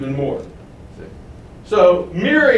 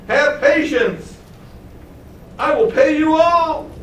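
much higher, slow desperate plea; begging debtor; 11 semitones higher than usual. Acted. A debtor groveling at his creditor's feet, begging high and slow. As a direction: plead for one more chance.

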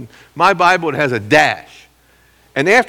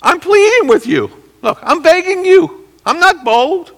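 much higher earnest pleading voice; Paul pleading; 5 dB louder than usual. Retold or imitated. Paul answering his accusers, pleading much higher. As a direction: beg them gently as Paul would. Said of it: "and he says".

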